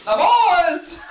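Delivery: sudden shrill cartoon cry; high squeaky exclaim.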